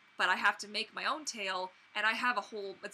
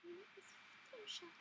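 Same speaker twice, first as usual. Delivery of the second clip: high, much softer Fluttershy voice; 10 semitones higher, 20 dB quieter than usual. Shown as a demonstration. Vlogger retrying a quieter Fluttershy impression. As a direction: breathe it high and soft like a timid pony. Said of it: "Is that better?".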